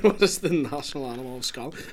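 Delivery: incredulous laughing repeat of a question. Real, not acted. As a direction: laugh through the words in disbelief.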